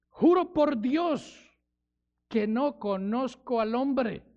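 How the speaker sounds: Peter's sworn denial, spoken slowly; solemn oath. Acted. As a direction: swear it slowly, word by word. Peter swearing he doesn't know Jesus.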